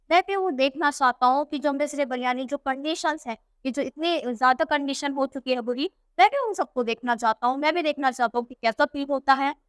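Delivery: ordinary talk, nothing marked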